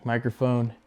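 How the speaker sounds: usual speaking voice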